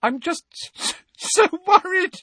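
very high, loud, stammering weeping; tearful outpouring. A narrator's reading. Molly confessing her fears for the family, weeping. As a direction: pour out every fear through sobs. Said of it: "tears spilling out of her eyes again".

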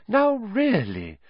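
high, slow mystic tut; mild reproach. Acted. Trelawney rebuking giggling students, high airy reproach. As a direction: chide them gently from on high.

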